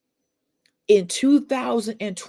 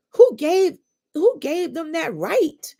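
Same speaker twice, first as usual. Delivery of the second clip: high, loud, indignant question; indignant challenge; 10 semitones higher, 5 dB louder than usual. Real, not acted. Challenging those who judge people with depression, indignant. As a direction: demand an answer, voice raised high in indignation.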